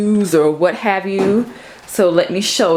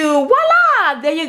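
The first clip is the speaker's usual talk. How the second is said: voila; 9 semitones higher, slower than usual. Real, not acted. Light turns on.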